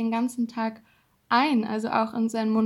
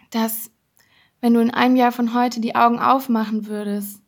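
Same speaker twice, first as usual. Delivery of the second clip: louder, glowing motivational guidance; uplifting motivational voice; 4 dB louder than usual. Acted. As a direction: fire up their dreams. Uplifting visualization pep talk. (begeisterte, laute Motivation)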